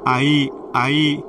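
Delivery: slow, drawn-out scared call for mother; frightened calling. A narrator's reading. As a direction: call out for mother in terror, dragging each word. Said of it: "हाका मारू लागली". (घाबरून, सावकाश आईला हाका मारणे)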